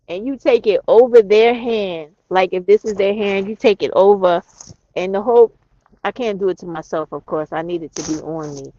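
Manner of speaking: usual speaking voice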